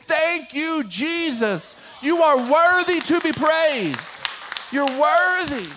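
loud, high, drawn to heaven; preacher echoing a child's worship; fervent praise. Retold or imitated. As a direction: proclaim the praise with full conviction. Repeats son's praise while preaching.

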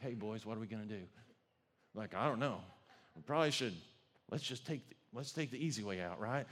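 low, hushed huddle talk, quick; huddled conspiring. Retold or imitated. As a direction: murmur like friends plotting in a corner. Three men whispering in a huddle.

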